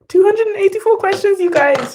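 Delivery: high, loud triumphant cry; excited squeal. Real, not acted. Student cheers to viewers after finishing the questions, excited. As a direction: shout the score in disbelief.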